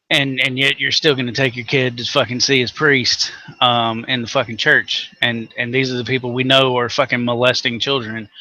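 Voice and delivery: normal talking voice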